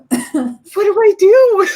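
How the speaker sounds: high, loud, helpless mock wail; mock tearful wail. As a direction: wail it quickly, imitating tearful panic. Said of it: "now I'm crying".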